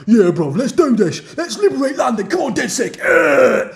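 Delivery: put-on loud, high, over-the-top London accent; hammed-up London rallying cry ending in a yell; mock Londoner. Londoner crew member rousing the team on a mission, hammed-up. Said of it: "some Londoners and they're like".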